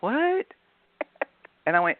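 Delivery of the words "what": surprised. Retold or imitated. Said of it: "she went".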